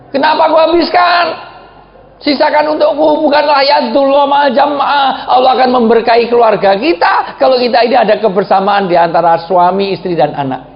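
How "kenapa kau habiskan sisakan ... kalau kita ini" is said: high, loud pleading cry; wife pleading with her husband to leave some; protesting wife. Acted. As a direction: call out the complaint in the wife's voice. Aisyah protests again.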